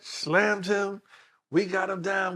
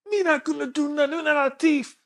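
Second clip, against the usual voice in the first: imitating Jamaican accent; 11 semitones higher, 3 dB louder than usual. Jamaican passenger protesting, voiced higher.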